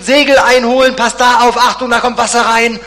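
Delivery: high, loud commands over the storm; shouted orders. Acted. Disciples shout orders in the storm, loud and high. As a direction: bark the orders against the wind. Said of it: "Die ersten Befehle werden gerufen".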